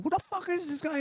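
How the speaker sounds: high, angry mock scream of an outraged brother. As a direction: yell it like the hothead brother on the phone. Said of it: "he's screaming in my ear".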